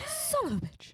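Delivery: high, prim transatlantic curse; genteel old Hollywood voice, mock; classy transatlantic accent. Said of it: "in the classiest way".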